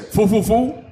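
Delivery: high, loud mock French babble; fake French. Joking at French request.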